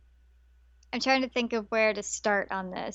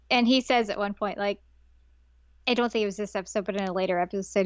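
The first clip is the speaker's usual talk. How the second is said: normal talking voice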